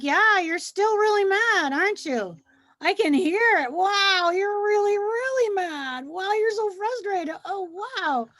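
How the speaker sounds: put-on mirror the child's anger back with big wide-eyed sympathy; exaggerated empathy. Teacher to raging child, high loud exaggerated sympathy. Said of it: "And I'm like".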